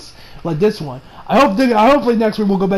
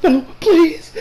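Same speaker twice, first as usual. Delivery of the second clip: pleading imitation; 8 semitones higher than usual. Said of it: "and she's like".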